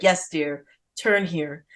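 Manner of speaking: low, subdued placating reply; imitated henpecked husband, low and soft; meek husband. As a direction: answer like a resigned husband. Meek husband answering yelling wife.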